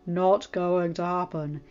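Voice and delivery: grudging, deadpan reply; grumpy Branch voice. Acted. Branch refusing.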